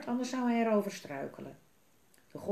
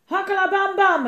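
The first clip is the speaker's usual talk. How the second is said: full-volume high shout; huge, high war cry; battle cry; 13 semitones higher, 14 dB louder than usual. A narrator's reading. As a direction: bellow a warning before attacking. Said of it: "riep Bobba".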